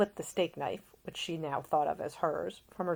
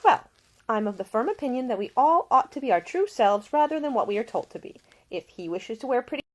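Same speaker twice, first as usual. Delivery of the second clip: higher, brighter-pitched caring lady; a young woman tending a seasick man, solicitous and higher; Ida, gentle young woman; 8 semitones higher, 5 dB louder than usual. A narrator's reading. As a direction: voice the attentive young lady nursing him. Ida speaking.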